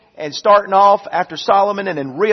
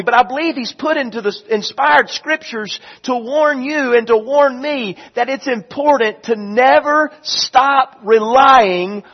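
fervent preaching, pushed louder and higher; 6 semitones higher, 5 dB louder than usual. Acted. Pastor urging his church to finish well, preaching hard. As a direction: drive it home from the pulpit.

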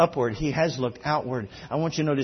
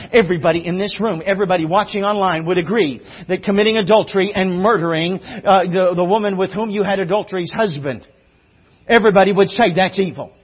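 loud, raised preacher's voice; punchy, high-energy sermon delivery; full-force preaching; 4 semitones higher, 10 dB louder than usual. Real, not acted. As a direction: drive it out to the whole congregation.

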